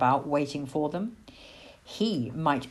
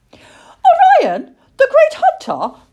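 high, loud little owl amazement; piping, thrilled disbelief; awestruck; 12 dB louder, slower than usual. A narrator's reading. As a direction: gasp out the famous name in wonder. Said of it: "breathed Plop".

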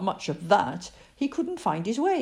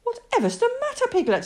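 put-on puzzled boy's question; faster than usual. A narrator's reading. Christopher Robin questions frightened Piglet.

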